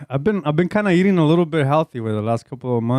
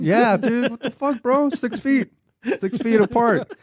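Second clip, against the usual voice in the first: mock outrage; 8 semitones higher, slower than usual. Outraged at hovering worker.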